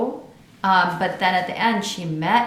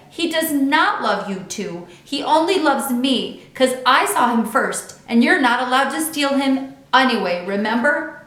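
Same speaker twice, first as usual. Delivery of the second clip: bossy and cross; 5 semitones higher, 6 dB louder than usual. A narrator's reading. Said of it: "She crossed her arms".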